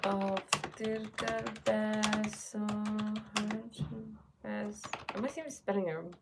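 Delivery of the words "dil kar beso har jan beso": drawn-out, quiet syllables; slow sounding-out. Real, not acted. Host typing the shabad as she speaks, slow sounding-out. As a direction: stretch each word slowly as if spelling along.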